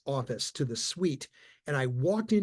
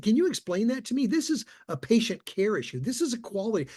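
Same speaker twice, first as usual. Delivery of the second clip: higher, heated retold rant; going off; 8 semitones higher than usual. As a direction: replay the outburst with pitch up. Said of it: "I just went off".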